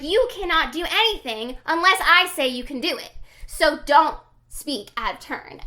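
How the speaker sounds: loud mock dressing-down; boss going in. Boss forbids her to speak out of turn, mock angry and loud. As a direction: lay down the law as an imitated angry boss. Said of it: "starts, like, going in on her".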